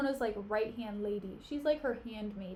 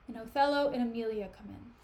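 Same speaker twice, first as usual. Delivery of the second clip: usual speaking voice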